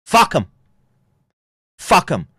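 loud, high, falling shout; high-pitched shouted contempt; scornful shout. Acted. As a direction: yell it as a short dismissive outburst. Scornful outburst after mock lament.

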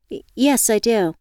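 high, stammering nervous yes. Acted. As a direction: trip over the first word nervously. Nervous candidate answers.

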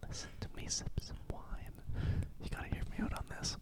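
soft, fully whispered invitation; playful ASMR-style whisper. Pretend. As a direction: whisper intimately into the mic. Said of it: "this is A S M R".

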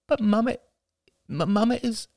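put-on high child voice, hesitant; child worrying about his mother, high and halting; worried small boy. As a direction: stammer like an anxious little boy.

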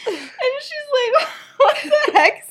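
very high, loud, laughing through the words; cracking up. Real, not acted. Teller retells the blunt joke, cracking up. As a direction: tell it through real laughter.

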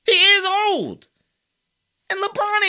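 high, loud exasperated insistence; exasperated outburst. Real, not acted. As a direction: hammer it home, stretching the repeat slowly. Exasperated rant at LeBron's defenders.

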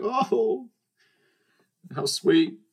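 high, drawn-out aww; touched cooing. Retold or imitated. Older sibling receiving brother's drawing, touched.

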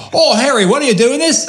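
put-on a silly Australian-accented gasp at Harry; mock Australian. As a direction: squeal it in a hammed-up Australian accent. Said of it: "I don't know why they're Australian now".

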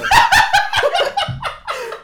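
high, loud burst of laughter. Real, not acted. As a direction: crack up.

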